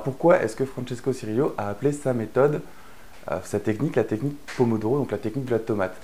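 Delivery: ordinary talk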